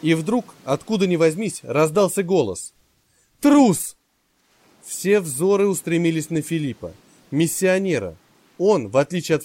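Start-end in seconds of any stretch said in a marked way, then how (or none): 3.4-3.9 high, loud accusing cry; shout. A narrator's reading. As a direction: hurl the word as a shout. A sudden shout from the crowd. (громкий выкрик)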